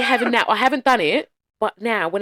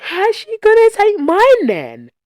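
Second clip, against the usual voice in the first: imitating a boy; 7 dB louder, much slower than usual. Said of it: "Greg just said".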